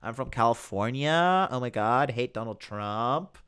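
mock Californian uptalk with swaying intonation; Southern California accent. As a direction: parody a Californian, stretching and lifting phrase ends. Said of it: "That's how they talk, like that swaying intonation".

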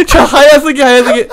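laughing panic. Real, not acted. Laughing panic while chased.

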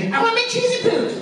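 high, bratty whining Cartman voice; upset Cartman. Retold or imitated. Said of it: "when he gets upset, he goes".

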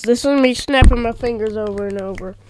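ordinary talk

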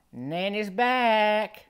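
drawn-out high call across the pool. Retold or imitated. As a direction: call it slowly after him like a granny. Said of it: "I just hear her call".

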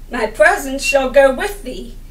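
ordinary talk

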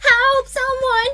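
high, drawn-out panicked call; cry for help. Acted. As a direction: call out desperately for rescue. Child calling for help.

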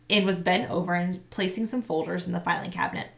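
plain reading voice

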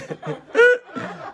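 loud, high burst of laughter. Real, not acted. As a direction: crack up at your own joke. Laughs at own near slip.

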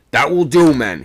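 loud, high command; somewhat sharp. A narrator's reading. Second mate to crowding men, somewhat sharply. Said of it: "somewhat sharply".

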